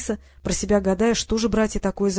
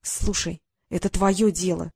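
ordinary delivery, nothing marked

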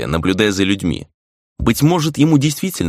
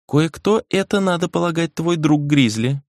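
put-on girl's character voice; 9 semitones higher than usual. A narrator's reading. Puppet girl's lines voiced in narration.

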